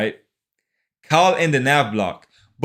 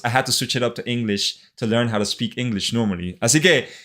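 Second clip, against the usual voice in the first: normal talking voice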